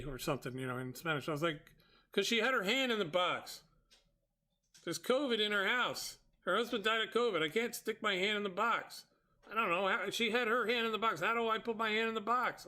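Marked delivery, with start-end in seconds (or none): 2.1-12.7 high-pitched, insistent retold objection; exasperated protest. Customer to driver about covid in her house, exasperated. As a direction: keep the pitch up as each reason piles on.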